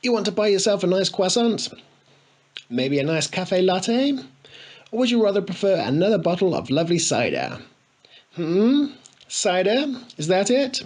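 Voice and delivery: high, sneering sing-song offers; mocking taunt. A narrator's reading. Spoilt boy taunting tramps with a coin, high sneer.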